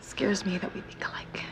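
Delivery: unvoiced whisper aside to her friend. Roommate whispering an aside about the creepy neighbor. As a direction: hiss it under your breath.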